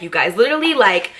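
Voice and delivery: ordinary talk, nothing marked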